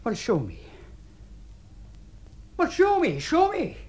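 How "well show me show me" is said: high, loud, insistent challenge; heated, repeated insistence; exasperated demand. Acted. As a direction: demand it with the voice pitched right up. Challenge repeated, losing patience.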